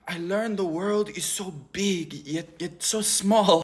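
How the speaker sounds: slow, soft, dreamy worldly-wise voice; pretentious traveler. Retold or imitated. Friend describing her trip abroad, pretentious and dreamy. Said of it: "talking with her hands".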